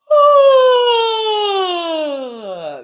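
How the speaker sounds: loud high yawning sigh falling away; a huge open yawn out loud; big yawny sigh. Shown as a demonstration. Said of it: "one more big yawny breath".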